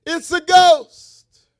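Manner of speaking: high, slow frightened cry; cried out in fear. Acted. Disciples seeing Jesus on water, frightened cry. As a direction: voice the terrified disciples. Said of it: "they cried out".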